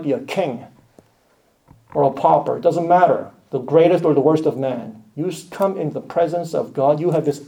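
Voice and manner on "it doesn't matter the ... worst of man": ordinary delivery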